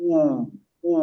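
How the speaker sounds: উ said as a round oo with lips pushed forward; rounded উ demo. Shown as a demonstration. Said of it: "সে গোল করে হবে". (ঠোঁট গোল করে উ)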